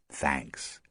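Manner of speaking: soft, nearly voiceless thanks; mouthed silently. A narrator's reading. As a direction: mouth it with barely any sound. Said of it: "She smiled and mouthed".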